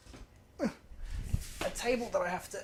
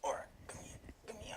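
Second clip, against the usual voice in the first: whispering; 3 semitones higher, 16 dB quieter, faster than usual. Real, not acted. Quietly calling the puppy.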